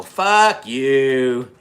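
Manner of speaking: loud, drawn-out mock yell; re-enacted heckle, loud and stretched; retold yell. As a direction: yell it across to another car. Retold road-rage shouting match.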